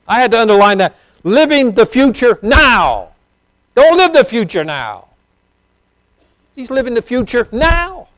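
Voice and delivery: high, loud pulpit cry, slowed on key words; fervent preacher lifting his voice high and loud; full-force preaching. Acted. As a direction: preach it out to the back row.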